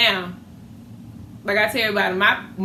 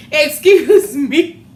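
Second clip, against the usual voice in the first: sassy retort; 6 semitones higher, 8 dB louder, much slower than usual. Retold or imitated. Retorting to mother's yell.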